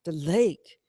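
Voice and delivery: usual speaking voice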